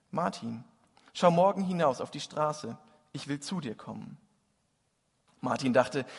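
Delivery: lively storytelling, warm and vivid; reading a Christmas story aloud; story narration. A narrator's reading. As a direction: tell the tale to the congregation. Preacher reads Tolstoy story aloud.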